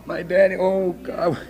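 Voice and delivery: low, halting, worried muttering; dreading daughter. Acted. Pregnant girl thinking of her daddy, low dread. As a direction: fret softly to yourself. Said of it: "if there's a daddy at home".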